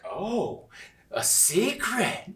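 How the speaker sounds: high, slow intrigued gasp. Retold or imitated. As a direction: lean in, hooked by a secret. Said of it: "the audience is like".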